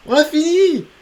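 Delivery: high, loud triumphant shout; victory cheer. Real, not acted. Victory, level finished.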